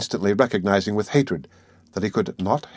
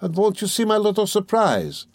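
high, lilting playful tease; chuckling playfulness; 11 semitones higher than usual. A narrator's reading. Examining lawyer stopping his suspect at the door, chuckling. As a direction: dangle the secret with a suppressed chuckle. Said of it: "chuckled Porfiry".

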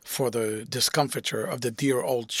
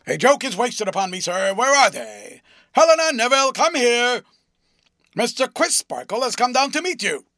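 fast, much louder and higher, calling across the yard; loud summons; 9 semitones higher, 8 dB louder than usual. A narrator's reading. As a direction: shout for Helena and Neville. Honeythunder loudly summons wards.

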